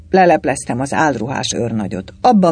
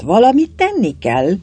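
pressing, raised-pitch insistence; urging; 8 semitones higher, 4 dB louder than usual. A narrator's reading. Galamb pressing the major to act, urging. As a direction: push for action with urgency. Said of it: "sürgette Galamb".

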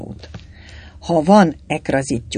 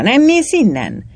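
angry, loud, high-pitched snap; furious growl; 10 semitones higher, 5 dB louder than usual. A narrator's reading. Galamb turning on the waiter lad, furious. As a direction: chase the lad off sharply. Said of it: "dühösen mordult a suhancra".

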